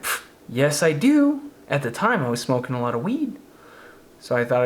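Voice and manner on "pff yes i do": scoffing then jumping higher on "I do"; mock-excited agreement, rising at the end; eager yes. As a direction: act like it's obviously a yes. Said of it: "I'm like".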